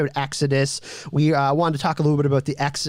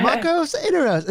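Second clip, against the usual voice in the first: put-on Marcos Inaros in a British accent, pitched much higher; 13 semitones higher than usual. Host copies Dominique's British accent on the name. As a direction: imitate a posh British actress saying Marcos Inaros. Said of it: "such a nice British accent".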